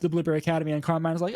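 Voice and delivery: ordinary delivery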